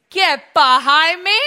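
slow, loud stern command; stern rebuke. Shown as a demonstration. Pastor leading congregation in Jesus' rebuke, loud. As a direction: rebuke him firmly. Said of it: "Say it with me".

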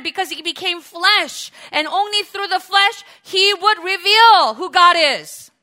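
loud, rising sermon climax; preaching at full force. Acted. Preacher to congregation, booming and building.

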